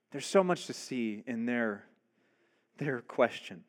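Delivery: ordinary talk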